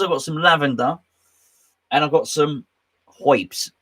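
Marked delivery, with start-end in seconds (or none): 3.1-3.7 hoips, herbs said the New York way as hoips; New York accent. Shown as a demonstration. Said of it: "That's how they say herbs in New York".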